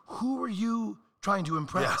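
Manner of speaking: high, slow mocking jab; mocking taunt. Teasing a balding buddy, high mocking voice. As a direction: tease him up high and drawn out. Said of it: "And I'm like".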